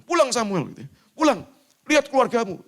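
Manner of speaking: high, loud urging; insistent teachers. Retold or imitated. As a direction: urge him home firmly. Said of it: "sama dosen-dosen saya dipaksa pulang". (dosen mendesak dengan suara tinggi)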